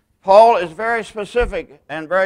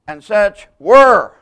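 "were" slow, loud and drawn out; "And such were" with "were" held long and loud; stress on were; 3 semitones higher, 10 dB louder, slower than usual. Shown as a demonstration. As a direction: lean hard on "were" and slow down. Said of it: "place emphasis on a different word".

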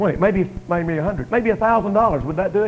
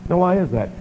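normal talking voice

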